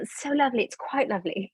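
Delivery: playfully put-on British accent. Mother clowning for her kids in British accent. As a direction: say it like an amateur doing refined British. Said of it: "you do a British accent very well".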